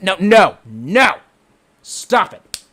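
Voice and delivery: loud, emphatic repeated refusal; mock scolding. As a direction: tell off the chatter in punchy bursts.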